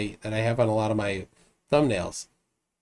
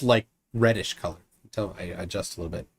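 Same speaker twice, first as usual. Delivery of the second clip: ordinary delivery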